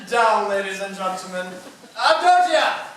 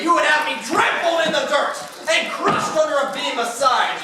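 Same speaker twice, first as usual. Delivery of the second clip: ordinary delivery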